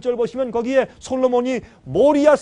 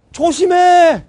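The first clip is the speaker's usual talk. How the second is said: sharp high yell of warning; urgent warning shout; 8 semitones higher, 11 dB louder, much slower than usual. Retold or imitated. Father yelling a warning to his child. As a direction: shout to stop the running child.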